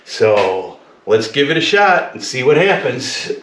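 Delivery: ordinary talk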